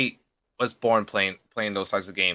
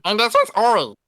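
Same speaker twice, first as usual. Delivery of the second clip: high, louder character voice; 11 semitones higher, 4 dB louder than usual. Shown as a demonstration. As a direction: pop into a bright cartoon voice. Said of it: "this right here is Tamar".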